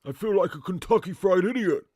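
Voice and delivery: loud put-on movie-boxer voice; loud, hammy action-star impersonation; Sly. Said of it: "Give Sly an impression of Sly".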